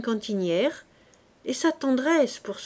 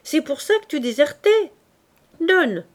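louder and higher, jovial; loud teasing demand; 6 semitones higher, 6 dB louder than usual. Acted. Sergeant teasing Fabrice and grabbing the bottle, loud. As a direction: tease then demand the bottle. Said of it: "s'écria le maréchal des logis".

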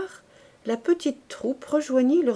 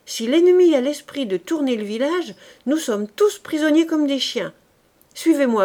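loud, higher commanding call; rally them, fast and forceful; urgent order; 5 semitones higher, 7 dB louder than usual. Acted. Corporal urging his men out of the trap, loud.

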